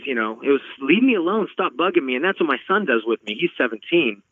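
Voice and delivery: ordinary talk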